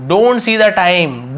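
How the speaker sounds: every word stretched; very slow model sentence. Shown as a demonstration. Teacher slowly modelling a sentence for students. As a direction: spell it out slowly.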